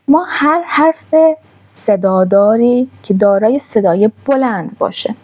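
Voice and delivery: usual speaking voice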